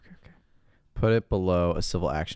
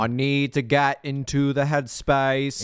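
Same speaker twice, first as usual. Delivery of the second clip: put-on slow, raised parody Boston accent; JFK; 6 semitones higher, slower than usual. Co-host joining the bit, hammed-up Boston accent. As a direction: draw out the presidential Boston vowels. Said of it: "He doesn't go full J F K".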